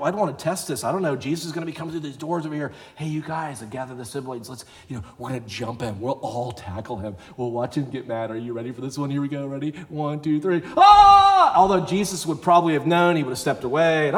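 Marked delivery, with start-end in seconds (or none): 3.0-10.6 conspiratorial hush. Acted. Sibling plotting to jump Jesus. 10.8-11.5 sudden full-throated playful war cry; mock ambush yell. Siblings jumping Jesus.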